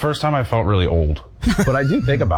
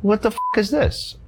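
incredulous; 11 semitones higher than usual. Retold or imitated. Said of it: "And I'm like".

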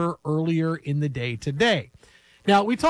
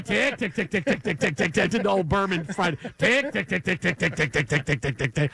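ticking clock. Pretend. Clock ticking waiting for doctor.

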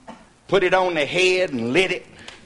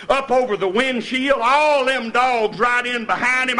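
ordinary talk

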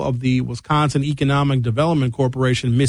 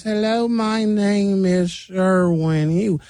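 higher, very slow Southern drawl; slowed-down Southern accent; 5 semitones higher, much slower than usual. Shown as a demonstration. Showing English slowed down, Southern accent. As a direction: draw every word out in a Southern accent. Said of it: "just slow it down and becomes a Southern accent".

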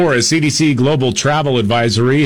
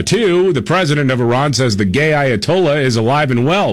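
ordinary delivery

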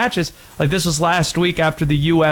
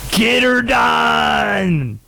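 put-on Southern accent; 6 semitones higher, much slower than usual. Friend mocking how Europeans do Americans, in a drawled Southern shout. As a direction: shout it like a Larry the Cable Guy fan. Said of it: "It's always the Southern accent".

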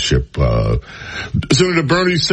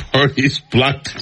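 words broken up by laughing; real laugh bursting mid-phrase; breaking into laughter. Real, not acted. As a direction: try to read on while cracking up. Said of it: "I didn't mean to laugh".

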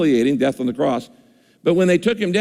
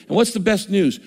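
usual speaking voice

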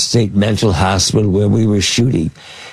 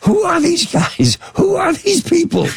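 impression of Jack. A co-star baffled by the actors at lunch, high and bewildered. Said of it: "Jack asks outside at lunch".

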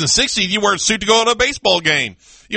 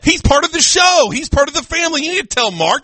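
indignant; 7 semitones higher than usual. Acted. Heated banter with co-host.

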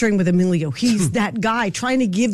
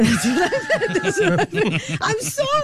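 words breaking into real laughter; can't get through it for laughing; 8 semitones higher, slower than usual. Real, not acted. Host cracking up about the leg guy, laughing.

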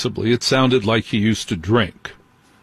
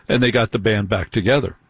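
normal talking voice

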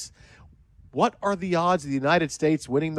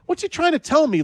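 higher, puzzled rising disbelief; baffled inner thought; 10 semitones higher than usual. Retold or imitated. Host puzzling over the veteran's warning, baffled. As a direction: wonder aloud what on earth he means. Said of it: "my thought is like".

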